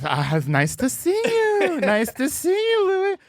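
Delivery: high, slow put-on Aziz voice; imitating Aziz. Louis impression voicing Aziz greeting Louis, high and slow. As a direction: stretch it out sweetly, lightly mocking. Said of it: "And then Aziz goes".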